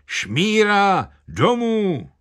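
high, drawn-out village heckler's cry; výkřik vesničana. A narrator's reading. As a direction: call it out high, stretching the vowels. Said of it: "jeden vesničan vykřikl". (vysoký protáhlý výkřik vesničana)

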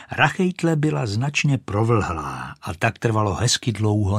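plain reading voice

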